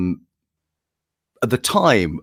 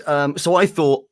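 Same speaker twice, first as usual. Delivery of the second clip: usual speaking voice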